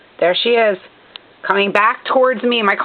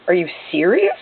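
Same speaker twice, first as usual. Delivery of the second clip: ordinary talk, nothing marked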